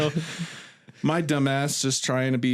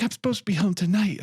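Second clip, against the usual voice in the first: higher, hurried mock complaint; imitating pissed-off complaint; 7 semitones higher, faster than usual. Stranded girlfriend to driver, mock pissed-off. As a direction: voice an annoyed girlfriend, pitched up and fast. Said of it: "She's all pissed".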